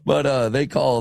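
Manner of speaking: ordinary talk, nothing marked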